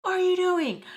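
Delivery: high-pitched outraged shriek, building to a loud finish; retold scream. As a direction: imitate a friend screaming in shock. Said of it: "Sugar screams".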